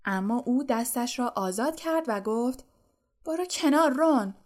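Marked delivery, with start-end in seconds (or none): 3.3-4.3 higher, clipped order to step aside; sharp command. A narrator's reading. Hermione orders Ron out of her way, sharply. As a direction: brush Ron off impatiently.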